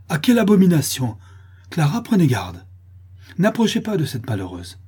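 loud, high cry of disgust; horrified exclamation. A narrator's reading. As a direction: warn your daughter away in horror. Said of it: "avec dégoût et horreur". (cri d'horreur et de dégoût)

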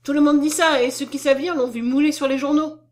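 frightened, forceful argument; superstitious dread. A narrator's reading. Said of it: "avec un superstitieux effroi".

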